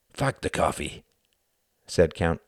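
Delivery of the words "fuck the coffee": ordinary delivery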